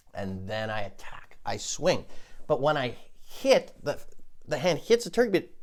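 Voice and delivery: ordinary talk, nothing marked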